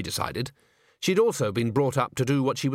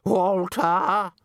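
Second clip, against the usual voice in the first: higher, more insistent drawn-out call; 6 semitones higher, 3 dB louder than usual. A narrator's reading. Missus Plinge calls Walter who has not come, insistently. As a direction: sing the name out high across a stage. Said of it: "Her voice echoed around the empty stage".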